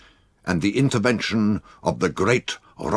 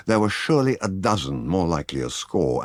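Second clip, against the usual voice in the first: ordinary delivery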